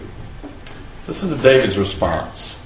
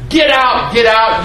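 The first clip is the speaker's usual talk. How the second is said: an angry man driving someone out; cursing shout; 8 semitones higher than usual. Acted. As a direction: hurl it at the king like a curse. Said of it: "Thus Shimei said in verse seven when he cursed".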